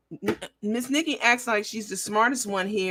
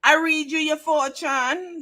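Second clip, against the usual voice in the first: high, slow fake Jamaican psychic; Miss Cleo; 7 semitones higher, slower than usual. Host corrects the psychic's wording, still in mock accent. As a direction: imitate a phony island fortune teller, drawn out. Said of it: "her accent was terrible".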